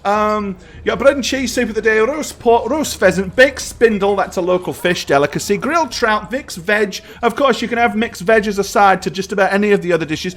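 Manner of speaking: high-pitched server reciting the menu; waitress character. Acted. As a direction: rattle off the dishes as a busy waitress. Game master plays waitress reciting menu.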